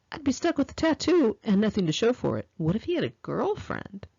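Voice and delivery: usual speaking voice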